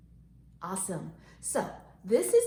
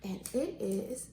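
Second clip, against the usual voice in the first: ordinary talk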